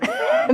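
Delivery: a quick, very high giggle; short squeal of laughter. Real, not acted. Laughs before the martini punchline.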